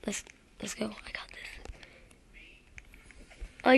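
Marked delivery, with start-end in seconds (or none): 0.1-1.7 hushed whisper to himself. Real, not acted. Gamer whispering to himself before a hard jump. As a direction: breathe the words, no voice at all.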